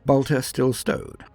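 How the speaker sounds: plain reading voice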